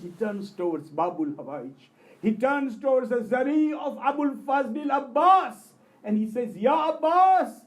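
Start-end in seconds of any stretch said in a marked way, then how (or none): 2.2-7.6 high, loud cry rising from the pulpit; impassioned shout. Retold or imitated. Preacher retelling the reciter's appeal at the shrine, impassioned shout. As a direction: call out to Abbas at full force. Climax of tale, calling on Abbas.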